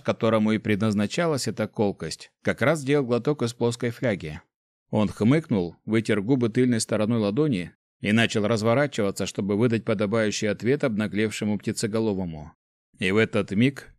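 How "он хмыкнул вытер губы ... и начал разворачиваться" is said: ordinary delivery, nothing marked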